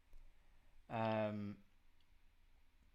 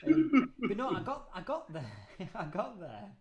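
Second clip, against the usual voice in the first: high, loud laugh through the words; 6 semitones higher, 9 dB louder than usual. Real, not acted. Brushing off his co-host's school kids jab, laughing loudly. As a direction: laugh it out while talking.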